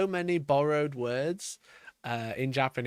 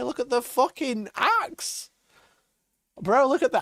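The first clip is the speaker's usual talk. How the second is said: excited; 8 semitones higher than usual. Real, not acted. Hyping the painted axe.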